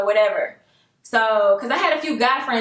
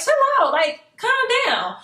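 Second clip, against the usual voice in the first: firm warning; 10 semitones higher than usual. Retold or imitated. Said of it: "I started getting serious".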